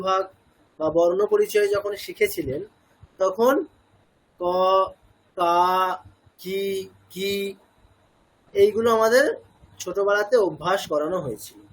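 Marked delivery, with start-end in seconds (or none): 4.4-7.3 ক, কা, কি, কি one at a time with pauses; clear syllable drill. Shown as a demonstration. Recalling the childhood primer drill, ক কা কি কি recited distinctly. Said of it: "স্বরবর্ণের উচ্চারণ স্পষ্ট করার জন্য".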